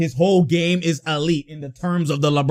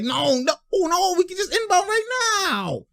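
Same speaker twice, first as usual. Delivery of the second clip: high, wailing imitated complaint; mock whining protest; 12 semitones higher, 5 dB quieter than usual. Imitating a player upset at the timeout, mock wail. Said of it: "'cause he called a timeout. He's like".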